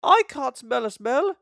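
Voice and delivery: high, light coach voices; fussy coach ladies; prim coaches. A narrator's reading. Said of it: "said Andy and Clarabel".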